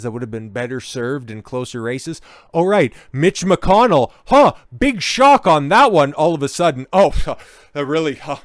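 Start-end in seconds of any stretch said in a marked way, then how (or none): 2.5-8.4 loud and high, sarcastic shock; mock surprise. Real, not acted. Mocking DeSantis's donor, loud sarcastic fake shock.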